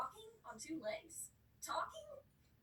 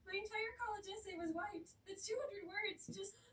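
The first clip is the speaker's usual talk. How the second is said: upset citizen; 8 semitones higher, 4 dB louder than usual. Retold or imitated.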